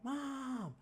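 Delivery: very high squeaky calling of names. Retold or imitated. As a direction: call for the family in a tiny high voice. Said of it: "screaming out their names".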